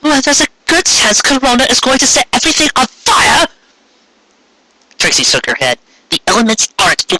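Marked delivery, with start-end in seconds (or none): 0.0-3.5 alarmed protest. Acted. Carrot Top protesting urgently.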